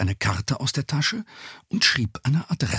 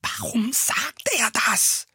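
high, slow whisper; whispered question; 13 semitones higher, slower than usual. A narrator's reading. Peter secretly asks Bob about Justus, whispering. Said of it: "eine geflüsterte Frage an Bob".

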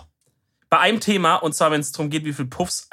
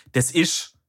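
"das ist" as dialect "des isch"; southern dialect; 2 semitones higher than usual. Shown as a demonstration. Southerner showing his own dialect form, southern des isch. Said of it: "dass es kein Hochdeutsch ist".

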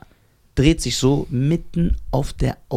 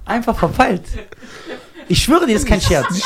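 said through laughter, pitched up; laughing; 7 semitones higher than usual. Real, not acted. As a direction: talk while still laughing at yourself. Laughing while telling crash story.

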